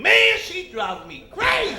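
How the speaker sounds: high, slow, loud complaint; hammed-up fed-up outburst; mock exasperated husband. Husband complaining to his friend about wife, mock exasperation.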